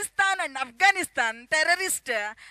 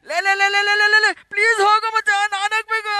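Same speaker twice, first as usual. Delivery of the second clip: fast, loud frantic begging; desperate pleading; 4 semitones higher, 6 dB louder, much faster than usual. Acted. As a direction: plead like you're bursting. Desperate to use toilet, pleading.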